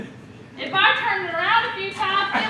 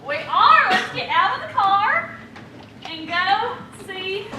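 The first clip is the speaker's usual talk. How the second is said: usual speaking voice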